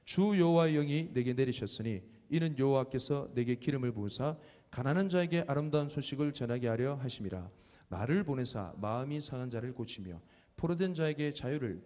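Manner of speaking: reading the verse aloud together, loud; loud, steady scripture reading. Shown as a demonstration. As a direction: proclaim the scripture to the hall. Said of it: "시작".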